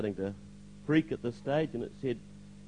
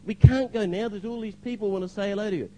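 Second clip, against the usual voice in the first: high-pitched retold objection; startled protest; 9 semitones higher, 5 dB louder than usual. Young man objects to pastor leaving people waiting, startled protest. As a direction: protest in surprise, pitch raised.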